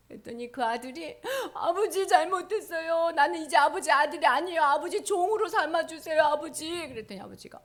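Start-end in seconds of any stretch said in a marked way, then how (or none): 1.5-6.9 pleading son. Acted. Son confesses to father.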